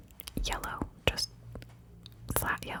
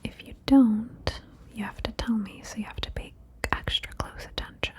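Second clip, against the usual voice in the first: normal talking voice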